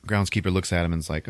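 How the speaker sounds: ordinary delivery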